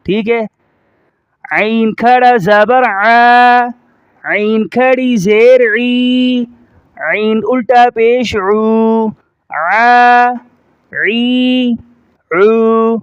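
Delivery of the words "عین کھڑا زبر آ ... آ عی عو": slow drill, "عین" squeezed in the throat, vowels held level; forceful ain. Shown as a demonstration. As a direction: press the start of "عین" and stretch "عی، عو". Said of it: "زور دے کر پڑھیں گے". ("عین" زور دے کر، کھینچ کر)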